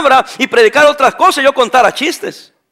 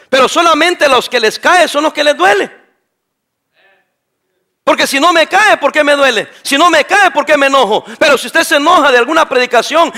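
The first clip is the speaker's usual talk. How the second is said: higher, pressing pulpit appeal; fervent preaching; 5 semitones higher than usual. Acted. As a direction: push the congregation toward repentance.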